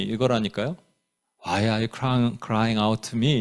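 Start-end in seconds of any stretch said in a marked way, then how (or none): 1.4-3.4 low, quiet inquiry with no reproach; mild, soft question. Shown as a demonstration. God asking Moses in the wrong nuance, soft low question. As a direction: ask softly and low, as if merely wondering. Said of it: "이러지는 않았을 거예요".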